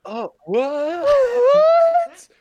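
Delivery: real disbelief, voice jumping way up; shocked squeal. Real, not acted. Shocked by friend's claim.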